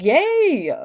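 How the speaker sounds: high, bright little yay of celebration; mock cheer. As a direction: cheer like a whole class clapping for you. Mock cheer of applauding class.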